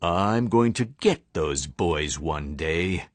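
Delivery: low, slow menacing vow; mean principal swearing revenge on George and Harold, low and menacing; villain vow. Acted. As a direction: growl the threat low and slow. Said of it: "Mister Krupp vowed".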